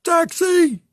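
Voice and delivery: high shout calling a cab; hailing a taxi. Retold or imitated. Passenger on the plane wing hailing a taxi, loud call. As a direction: yell like flagging a New York cab.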